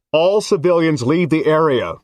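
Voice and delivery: high, loud amplified announcement; bullhorn announcement. Acted. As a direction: chant it through a bullhorn. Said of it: "the bullhorn chanted".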